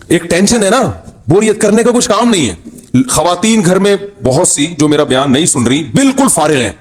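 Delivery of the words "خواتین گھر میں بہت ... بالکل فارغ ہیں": ordinary talk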